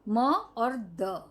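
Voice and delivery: spelling it out. Shown as a demonstration. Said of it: "ये है मद".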